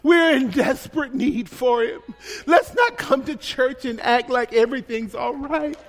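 usual speaking voice

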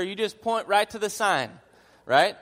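ordinary delivery

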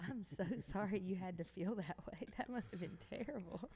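imitating someone apologizing through laughter; laughing apology. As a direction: say sorry while cracking up. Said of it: "he laughed so hard".

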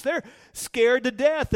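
usual speaking voice

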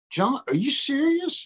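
high, slow disbelieving question; can't believe what he just heard; slow shocked rise; incredulous engineer. Retold or imitated.

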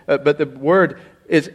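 usual speaking voice

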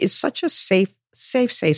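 ordinary talk

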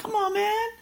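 high, breathy-free mock plea; exasperated. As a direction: sigh it out, fed up and pleading high. Exasperated retold inner complaint.

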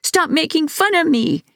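high screeching plea of rage; shrill furious screech. A narrator's reading. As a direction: screech it, out of control. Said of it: "he screeched".

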